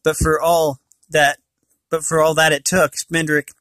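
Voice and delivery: plain reading voice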